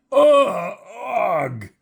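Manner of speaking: high, loud cry of revulsion; high-pitched grossed-out yelp; disgusted scream. A narrator's reading. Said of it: "she screamed".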